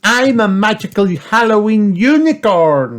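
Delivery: put-on high, loud, magical child voice; proud Peppa. A narrator's reading. Peppa shows off as a unicorn, high loud voice. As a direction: announce it grandly in a high voice. Peppa announces her costume.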